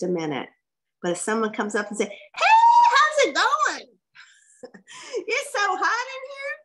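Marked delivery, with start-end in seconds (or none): 2.1-6.5 much higher, louder, bubbly gush with a laugh; excited customer. Retold or imitated. Vendor table visitor gushing to the seller: giddy high-pitched chatter. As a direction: customer bursts in, hyped up about the heat: squealing high excitement. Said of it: "mirror their level of excitement".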